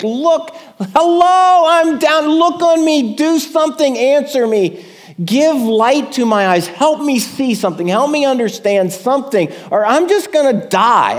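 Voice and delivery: high, loud crying out for help; desperate plea. Acted. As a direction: shout up to God, begging to be seen. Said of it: "Can you feel the desperation?".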